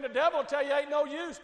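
ordinary talk, nothing marked

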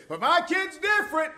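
high mock protest; whiny parent objection. Preacher mocking a parent's protest in a high voice. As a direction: play the defensive parent, pitched high. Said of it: "you say".

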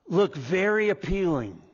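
ordinary delivery

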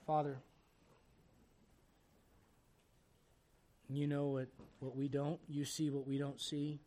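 earnest prayer with music beneath; pastoral prayer. Acted. The pastor prays over his bowed congregation, earnest and humble.

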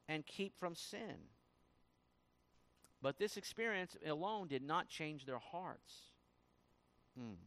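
ordinary talk

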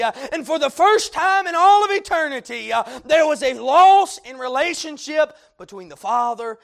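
loud, high preaching climax; full-force preaching. Acted. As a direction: drive it home from the pulpit.

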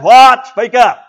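a loud, high "What?" then "Speak up"; mock loud demand. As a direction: call it out like God asking. God asks Solomon to speak.

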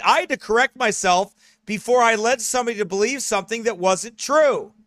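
loud, rising sermon voice; full-force preaching. Acted. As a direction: drive the warning home to the congregation.

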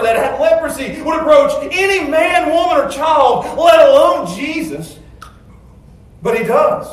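loud, high, driving sermon voice; fiery preaching. Real, not acted. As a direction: preach it with rising fire. Preaching at full force.

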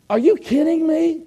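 high, exasperated disbelief; incredulous. Retold or imitated. Evangelist fumes at the pastor.

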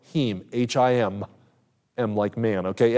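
normal talking voice

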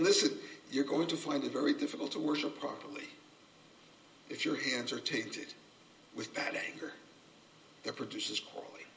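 soft, hushed and earnest; quiet voice of God. Acted. God warning worshippers, softly voiced. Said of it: "God here is saying".

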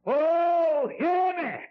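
high, loud preacher's cry; loud appeal. Real, not acted. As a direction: call out urgently to the congregation.

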